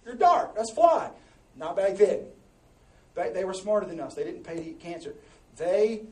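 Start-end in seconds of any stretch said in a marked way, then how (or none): 0.0-1.1 higher, louder mock modern take; playful hyped approval, higher and louder; mock hip approval. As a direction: toss it off like it's cool. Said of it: "we think that sounds like".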